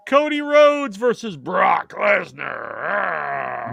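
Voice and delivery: long, drawn-out ring announcer bellow; match announcer. Pretend. Host introducing the next match to friends, hammed-up announcer. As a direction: stretch out the wrestlers' names, roaring the last.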